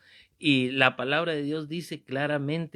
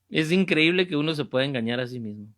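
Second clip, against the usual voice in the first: ordinary talk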